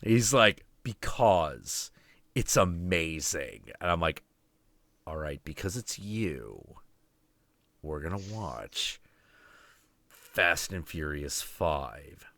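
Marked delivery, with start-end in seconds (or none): 0.8-3.6 low, slow, hushed answer; retold solemn friend. 5.0-12.2 low, soft, halting surrender; subdued, grudging low murmur; retold reluctant consent.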